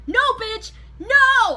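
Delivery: high, loud cussing shout; yelling. Retold or imitated. Student screaming back at the principal. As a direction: scream the refusal. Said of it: "I actually was cussing at this point".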